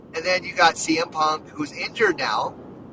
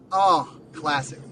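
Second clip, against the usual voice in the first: ordinary delivery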